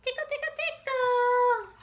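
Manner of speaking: very high squeaky tickle; playful tickle. Acted. Mama tickling Deanie, very high and playful. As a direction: tease like tickling a child.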